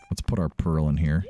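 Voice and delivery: ordinary talk, nothing marked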